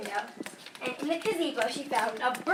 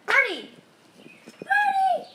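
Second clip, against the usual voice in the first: coaxing a wild bird; 6 dB louder, slower than usual. Real, not acted. Calling bird out of gazebo.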